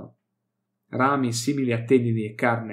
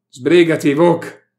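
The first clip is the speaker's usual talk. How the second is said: very loud, higher yell; shouted; 6 semitones higher, 12 dB louder, slower than usual. Acted. Inquisitor yells at Vok to hurry, very loud. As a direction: bellow at him to hurry.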